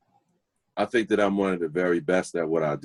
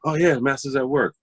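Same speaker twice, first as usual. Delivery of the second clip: higher, brighter "Oh, yeah" of pleasure; delighted recognition; 7 semitones higher than usual. Retold or imitated. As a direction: greet the record like an old friend. Recognizing record while tidying.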